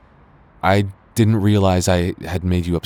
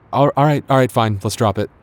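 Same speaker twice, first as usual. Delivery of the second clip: exasperated giving in; 6 semitones higher, 4 dB louder, faster than usual. Acted. Exasperated giving in mid-argument.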